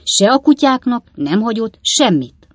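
loud, high, emphatic denial; firm, decided. A narrator's reading. Sam denying any bequest to the fold, in a firm voice. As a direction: answer firmly, allowing no doubt. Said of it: "felelte Sam határozott hangon".

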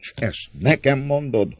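high-pitched, indignant question; agitated, rising outburst; trembling with passion. A narrator's reading. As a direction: throw the question back, deeply wounded. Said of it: "Szólt indulatában remegő hangon".

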